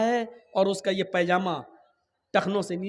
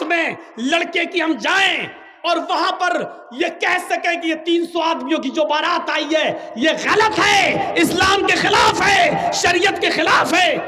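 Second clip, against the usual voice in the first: fiery preaching; 10 semitones higher, 9 dB louder than usual. Acted. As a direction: preach it full force, louder with each phrase. Preacher builds to full force.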